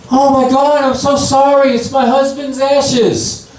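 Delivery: high, frantic mock scream; screaming apology. Widow to a stranger covered in ashes, screamed apology. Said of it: "this lady screams out".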